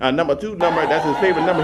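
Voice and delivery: ordinary talk, nothing marked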